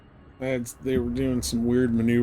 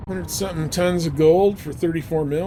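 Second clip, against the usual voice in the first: higher and louder, rushing the last bit; excited; 5 semitones higher, 4 dB louder than usual. Real, not acted. Streamer to chat, excited about a cheap gold haul. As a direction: hype up the bargain you just found, a touch quicker.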